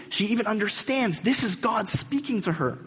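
high, loud fervent preaching; preaching at full force. Real, not acted. Preacher thundering to his congregation about grace. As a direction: drive the grace home to the congregation.

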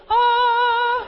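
very high, loud sung "Ah"; operatic scream. Retold or imitated. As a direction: turn the scream into an opera note. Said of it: "It was like an opera event".

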